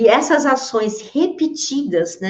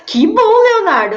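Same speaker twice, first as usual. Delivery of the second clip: bright laughing burst, much higher and louder; laughing delight; 10 semitones higher, 5 dB louder than usual. Real, not acted. Host laughing happily at viewer Leonardo's comment. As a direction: laugh with real joy at the comment.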